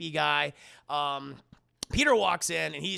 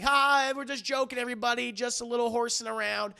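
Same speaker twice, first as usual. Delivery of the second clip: higher, forced-cheerful singsong; mock-cheery cover-up; 4 semitones higher, 3 dB louder than usual. [name] reassures the office during scuffle, high mock cheer. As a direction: brush it off high and breezy.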